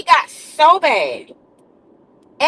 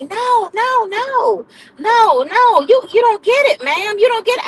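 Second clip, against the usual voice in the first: mock-insistent, a little higher, repeating no; insistent patients; 6 semitones higher than usual. Patients insisting the nurse doesn't understand. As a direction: imitate them pleading you don't get it.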